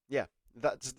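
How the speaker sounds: usual speaking voice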